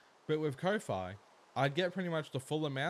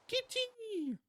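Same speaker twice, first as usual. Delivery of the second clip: delighted squeal. Real, not acted. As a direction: gasp then a much higher cry of Kitty. Sees her cats.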